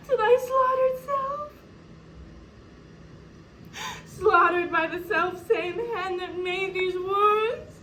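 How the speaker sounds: high, sobbing grief; crying as if to break down; tearful lament. Acted. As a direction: wail to the dead man's ghost. Widow laments over slain king's body.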